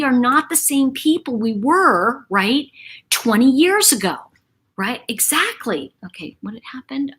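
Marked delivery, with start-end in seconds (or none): 6.1-7.1 whispered aside; quick whispered aside. Real, not acted. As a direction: whisper it to yourself. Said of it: "reading comments aside".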